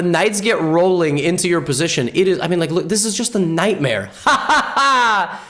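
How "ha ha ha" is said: high, punchy "ha, ha, ha"; hammed-up high cackle; mock laugh. Laughing at own accidental pun.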